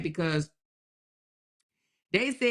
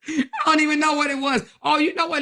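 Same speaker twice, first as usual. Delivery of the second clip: laughing; 8 semitones higher, 4 dB louder, faster than usual. Real, not acted. Laughing after admitting ignorance.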